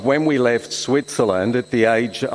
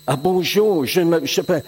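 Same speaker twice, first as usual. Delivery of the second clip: light mock French chatter, higher; imitating French kids; 5 semitones higher than usual. Boy on the ship mimicking French kids, lightly higher. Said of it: "they're all speaking other language".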